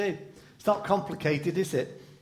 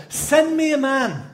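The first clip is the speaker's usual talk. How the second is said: pleading cry; 8 semitones higher, 6 dB louder than usual. Pretend. Gladys prays for a husband.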